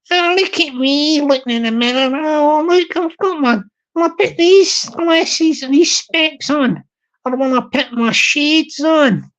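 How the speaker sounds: lightly put-on Glaswegian woman preening; Glaswegian lady. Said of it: "the wee Glaswegian ladies".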